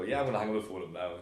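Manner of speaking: rushed mock brush-off; fast. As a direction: gabble it out to get away. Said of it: "so you just go".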